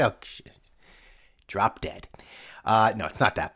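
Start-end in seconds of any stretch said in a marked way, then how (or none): 1.5-2.1 muttered aside. Retold or imitated. Muttered under breath after greeting.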